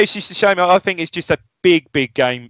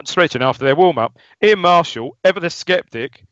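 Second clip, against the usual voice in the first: ordinary delivery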